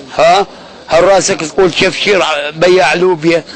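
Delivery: loud, heated taunting; angry yell. Acted. Demanding what Brawish wants from the group, in a loud angry taunt. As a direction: bark the questions at him.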